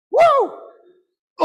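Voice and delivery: loud, high-pitched woo; excited whoop. Real, not acted. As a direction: let out a thrilled whoop. Whoop after quoting moving song.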